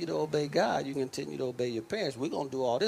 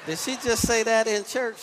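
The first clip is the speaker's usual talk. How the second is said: higher, louder, laughing disbelief; amused shock at a church remark; laughing surprise; 9 semitones higher, 7 dB louder than usual. Real, not acted. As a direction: tease the church member with mock shock. Preacher teases a congregant.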